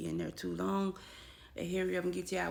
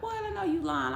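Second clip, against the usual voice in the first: put-on disbelieving boyfriend; 10 semitones higher, 6 dB louder than usual.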